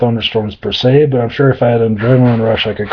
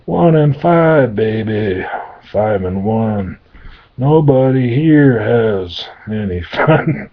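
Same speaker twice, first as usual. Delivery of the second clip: slow demon drawl; much slower than usual. Acted.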